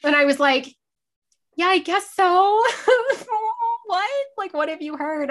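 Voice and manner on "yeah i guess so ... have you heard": terrified. Retold or imitated. Fan confronted by her idol about rumours, terrified. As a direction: imitate a scared fan squeaking an answer. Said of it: "And I'm just terrified".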